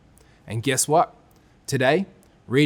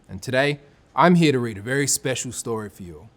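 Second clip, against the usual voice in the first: ordinary delivery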